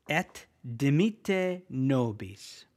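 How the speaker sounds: slow, careful "Et dimitte nobis" with a crisp T in "Et"; deliberate teacher model, stress on "dimitte"; Latin pronunciation. Shown as a demonstration. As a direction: sound out "Et dimitte nobis" for learners. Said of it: "the way that we say".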